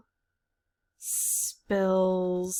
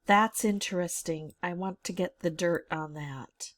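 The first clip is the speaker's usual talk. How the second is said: ordinary talk, nothing marked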